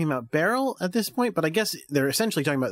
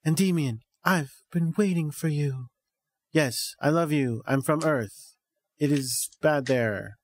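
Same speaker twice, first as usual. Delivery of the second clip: mock wooden line reading, with odd pauses; hammed-up stilted dubbing voice; fake stilted acting. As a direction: recite it stiffly like a terrible actor. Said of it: "talk in the fakest, most stilted voices".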